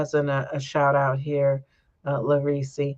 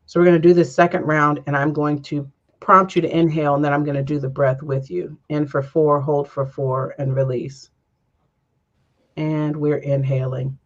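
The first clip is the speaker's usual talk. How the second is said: guided breathing. Acted. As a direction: lead the breath gently, unhurried. Leads a breathing meditation.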